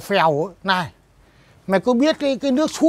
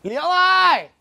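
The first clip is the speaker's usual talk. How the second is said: calling out; 5 semitones higher than usual. Acted. Calling the daughter out.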